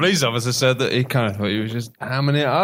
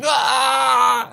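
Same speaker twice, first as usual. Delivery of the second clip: writhe and cry out in pain; pained yell; 10 semitones higher than usual. Pretend. Wanted you writhing on floor.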